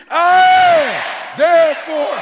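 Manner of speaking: high, loud, shouted I am; shouted declaration. Shown as a demonstration. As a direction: shout it like you mean it. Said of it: "say it like you mean it".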